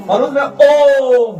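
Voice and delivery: Balloon में ओम said slowly, ओम drawn out; slow careful om. Shown as a demonstration. Teacher answering a student, slowly drawing out om. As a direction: spell out ओम slowly for the class. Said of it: "balloon का कौन लाएगा?".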